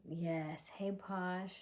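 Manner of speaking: usual speaking voice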